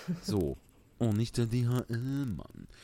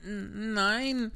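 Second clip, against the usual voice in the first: put-on high, hesitant boy's no; guilty Pinocchio; 9 semitones higher than usual. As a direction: stammer a small guilty no, high up. Pinocchio caught out.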